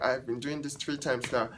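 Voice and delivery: usual speaking voice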